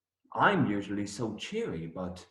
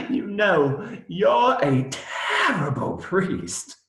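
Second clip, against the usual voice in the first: laughing tease; 10 semitones higher, 3 dB louder, slower than usual. Acted. Teasing the priest.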